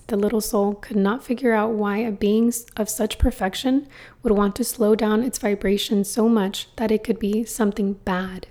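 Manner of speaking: ordinary delivery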